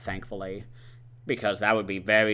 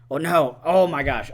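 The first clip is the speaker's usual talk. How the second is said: higher, louder exclamation of dismay; startled oh-no, pitch jumping up; sudden realization; 5 semitones higher, 7 dB louder than usual. Real, not acted. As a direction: catch yourself mid-story, suddenly louder. Interrupts self, rescinds what was said.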